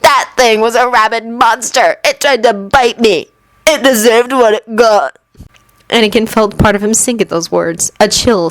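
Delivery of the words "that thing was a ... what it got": lightly voiced jeering boy; a hint of a sneering bully. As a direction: third bully jeering about the hurt creature. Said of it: "the third boy jeered".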